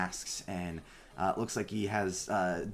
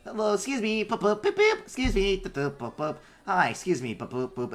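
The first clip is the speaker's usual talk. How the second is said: high, loud beeping robot voice; tiny robot courier, much higher, booping between words; little runner bot; 11 semitones higher, 5 dB louder than usual. Acted. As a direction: chirp like a busy little delivery bot. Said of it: "little runner bots".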